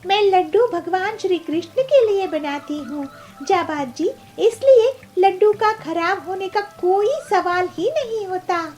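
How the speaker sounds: high, emphatic heroine voice; devout seller, confident. Acted. Peelu assuring Jabaaz her laddus never spoil, high and confident. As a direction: assure him firmly in a high voice.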